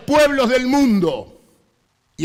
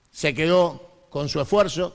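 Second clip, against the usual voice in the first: normal talking voice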